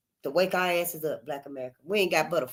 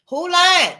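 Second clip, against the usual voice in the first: indignant; 10 semitones higher, 7 dB louder than usual. Real, not acted. Indignant question.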